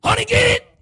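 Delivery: high, loud squeal for help; hammed-up panicked yelp; comic mouse panic. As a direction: squeal it like a mouse just ran by. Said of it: "Like me when I see a mouse".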